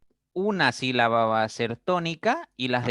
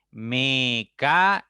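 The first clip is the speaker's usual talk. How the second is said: "Mecánico" slow and loud as me - ca - ni - co; syllable by syllable; 5 dB louder, slower than usual. Shown as a demonstration. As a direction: break "Mecánico" into four separate beats, me, ca, ni, co. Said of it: "Tiene cuatro sílabas".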